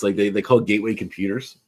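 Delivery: ordinary talk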